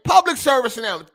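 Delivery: called out loud and high like a PSA; booming public service announcement. Real, not acted. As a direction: proclaim it to the whole chat. Announcing his warning to viewers.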